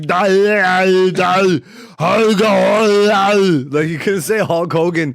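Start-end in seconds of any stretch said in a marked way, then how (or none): 0.0-3.6 slow, higher, louder giant mumbling "Dolly"; mock Andre the Giant slurring "Dolly"; imitating Andre the Giant. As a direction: mumble "Dolly" like the giant who can't say the name. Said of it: "he sounded like".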